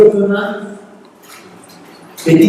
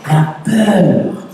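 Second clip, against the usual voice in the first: normal talking voice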